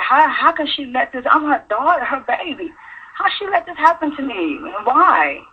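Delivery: hurt disbelief. Retold or imitated. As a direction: plead for an answer as a wronged daughter. Voicing thoughts toward mother.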